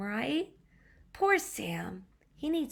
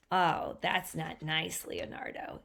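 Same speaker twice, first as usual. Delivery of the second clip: ordinary delivery, nothing marked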